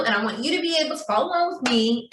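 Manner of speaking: usual speaking voice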